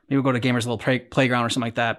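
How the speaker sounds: normal talking voice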